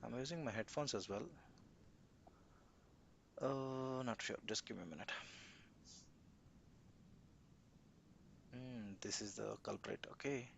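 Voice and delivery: faint, distant voice off the mic; muffled and far from the microphone; barely audible. Real, not acted. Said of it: "Your voice is bit low".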